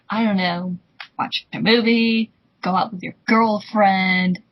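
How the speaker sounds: usual speaking voice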